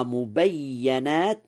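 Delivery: mubayyanat, with an a after the ya; fatha on ya of مبينات. Shown as a demonstration. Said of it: "بفتح الياء".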